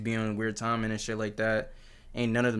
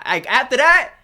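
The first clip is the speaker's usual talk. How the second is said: loud, higher, drawn-out declaration; emphatic outburst; 4 semitones higher, 8 dB louder, slower than usual. Real, not acted. Reactor concludes no celebrity is safe, loud emphatic outburst. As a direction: hammer it loudly, stretching the key word.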